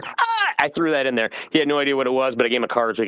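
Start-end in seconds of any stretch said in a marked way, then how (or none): 0.0-0.5 high-pitched laughing "ah!"; sudden high cackle at own joke; laughing yelp. Real, not acted. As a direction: burst out laughing in a high squeal.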